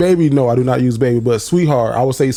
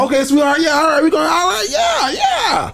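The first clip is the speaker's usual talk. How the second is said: much higher, rising to a mock shout; hammed-up eager greeting; 13 semitones higher than usual. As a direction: ham up a gushing hello to a stranger. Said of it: "Then I'd be like".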